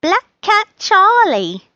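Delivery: high, loud, slow cat voice; cat announcing himself. Acted. Charlie answers Rose through the curtain, high drawn-out announcement. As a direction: draw the name out high and loud.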